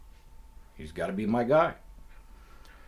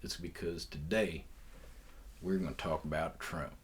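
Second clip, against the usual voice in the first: put-on Southern accent on "we're gonna talk about Trump"; 3 semitones lower, 10 dB quieter than usual. Podcaster announcing the topic in a mock Southern accent. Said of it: "this horrible southern accent".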